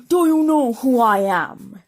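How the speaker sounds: loud, slow, commanding king; slow booming royal demand; deep royal voice. A narrator's reading. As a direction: boom it out like a proud king. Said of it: "he demanded in a deep royal voice".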